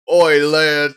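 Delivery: loud put-on accent slipping into Texas; botched Texas-sounding accent. Streamer trying out an "Oi, lad" act, accidental Texas accent. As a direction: attempt an accent that comes out oddly Texan, loudly. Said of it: "why is that like a Texas accent?".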